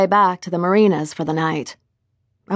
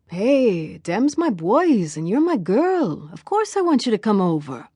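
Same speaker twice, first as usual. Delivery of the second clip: put-on lilting Jamaican accent; 3 semitones higher than usual. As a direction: lean into the Jamaican lilt, playful and affectionate. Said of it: "lilting Jamaican accent".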